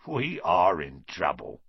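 menacing Filch. Acted. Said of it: "he whispered".

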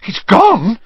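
high, loud, disbelieving cry; frantic. A narrator's reading. Harry learning Dumbledore has left, frantic. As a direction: burst out in dismayed panic. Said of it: "said Harry frantically".

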